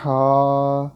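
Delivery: ہا held long and loud from the throat; drawn-out letter name. Shown as a demonstration. Teacher spells for students, long loud ہا. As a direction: stretch ہا as in spelling. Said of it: "حلق کے درمیان سے ہی پڑھنا ہے".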